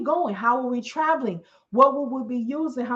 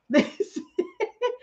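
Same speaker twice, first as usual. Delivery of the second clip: high laugh. Real, not acted. Laughs at greeting scene.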